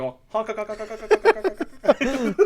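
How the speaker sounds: high, loud honking with laughter; goose honks. Retold or imitated. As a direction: honk like a mischievous goose. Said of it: "I'm like".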